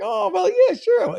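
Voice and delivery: lightly mimicked breezy texter, pitched high; Peele. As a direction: toss it off high, as an easygoing reply. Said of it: "Peele's just like".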